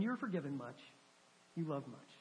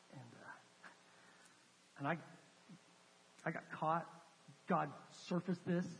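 low, subdued, halting retelling; humbled confession; 3 semitones lower, 3 dB quieter than usual. Retold or imitated. Tearful man confessing his unfaithfulness to a visitor, humbled and subdued. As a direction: quietly voice a man's painful confession, pausing often. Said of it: "tears coming out of his eyes".

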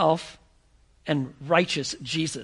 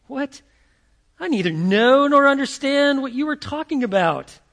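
high, loud flustered denial; Peter denying; 6 semitones higher, 5 dB louder than usual. Acted. Peter protesting to the accusers, loud flustered denial. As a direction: blurt it out as if caught off guard.

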